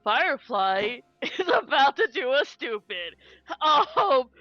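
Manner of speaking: giggling through the words; laughing at her own plan. Real, not acted.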